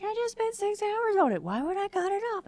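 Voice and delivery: high, incredulous protest; exasperated whine. Retold or imitated. Wife protesting to husband, high and incredulous. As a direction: sound outraged at the idea. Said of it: "And I'm like".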